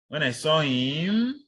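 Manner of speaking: "him" drawn out and climbing high; slow, leave it hanging upward on "him"; rising, unfinished clause. Shown as a demonstration. Teacher showing an uncompleted sentence part, rising intonation. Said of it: "normally it should go up".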